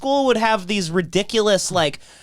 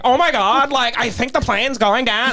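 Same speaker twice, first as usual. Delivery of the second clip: Valley Girl imitation; 6 semitones higher than usual. Mocking the pilot to amuse the class, hammed-up Valley Girl. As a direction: ham up the Valley Girl crash line. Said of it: "Like Valley Girl".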